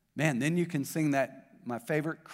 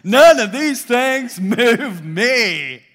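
loud, high, drawn-out rallying shout; like you mean it; 8 semitones higher, 14 dB louder, much slower than usual. Shown as a demonstration. Preacher leading the congregation to repeat it, shouting like he means it. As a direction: belt it out slowly with full conviction. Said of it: "Let's say it like we mean it".

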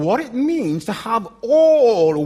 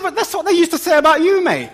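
quick, higher, louder Cockney retort with a laugh; Cockney accent; 11 semitones higher, 5 dB louder, much faster than usual. Retold or imitated. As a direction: fire it back in a cheeky Cockney accent. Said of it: "I thought I'd give him my Cockney accent".

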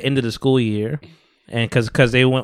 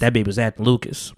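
Stephen A impression; 6 semitones lower, 6 dB quieter than usual. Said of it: "I gotta get my Stephen A right now".

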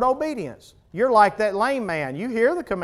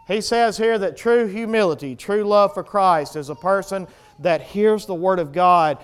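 ordinary talk, nothing marked

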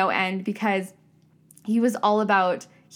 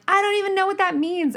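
exasperated high whine; 12 semitones higher, 3 dB louder than usual. Retold or imitated. A student baffled by her professor's answer, high exasperated whine. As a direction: voice jumps up high in frustration. Retold frustrated reply.

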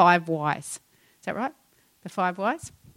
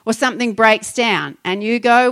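usual speaking voice

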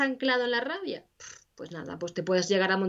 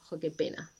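soft mock sorrow; 4 semitones lower, 8 dB quieter than usual. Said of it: "y luego".

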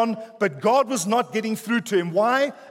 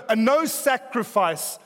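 ordinary talk

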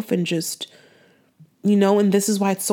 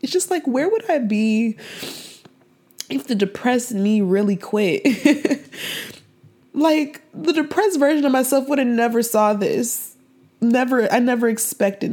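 tearful, high voice; 4 semitones higher than usual. Real, not acted. To her listeners, recalling her depressed past, tearfully. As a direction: voice pitched higher, close to tears. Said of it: "I'm just really emotional".

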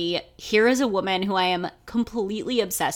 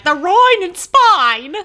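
much higher, louder, slow mock cockney; Eliza Doolittle cockney; 10 semitones higher, 9 dB louder, slower than usual. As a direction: ham up a cockney flower-girl voice. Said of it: "Eliza Doolittle".

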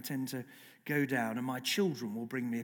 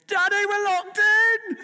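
put-on very high, slow, drawn-out child's scream; imitated screaming toddler; 14 dB louder, slower than usual. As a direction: wail it at full volume like a trapped child. Imitates locked-in toddlers yelling for father.

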